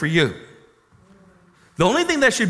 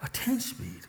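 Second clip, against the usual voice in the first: much softer, breathy disbelief; stunned echo; 5 semitones higher, 13 dB quieter than usual. Acted. Man repeating God's gift to himself, hushed and stunned. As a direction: let it fall away in disbelief.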